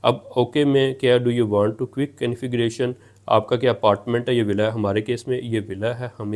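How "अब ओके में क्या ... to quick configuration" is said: ordinary talk, nothing marked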